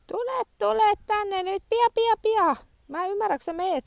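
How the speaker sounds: very high coaxing call to a dog. Real, not acted.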